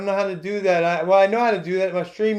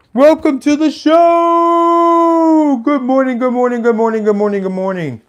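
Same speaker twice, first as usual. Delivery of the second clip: over-the-top morning show announcer welcome; morning show host impression; 7 semitones higher, 7 dB louder than usual. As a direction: boom it out like a hyped radio host. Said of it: "That's my brilliant impression of somebody".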